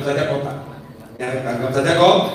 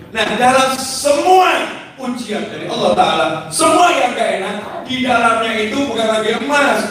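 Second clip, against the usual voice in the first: fervent preaching; 7 semitones higher than usual. Acted. Preacher rebukes the congregation for complaining, fervent preaching. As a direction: preach it out with full conviction. Preaching rises to full force.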